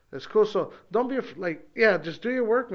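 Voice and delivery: usual speaking voice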